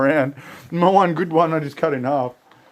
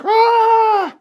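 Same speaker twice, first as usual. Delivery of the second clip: mock yell; 4 dB louder than usual. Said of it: "I was like".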